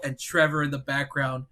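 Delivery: usual speaking voice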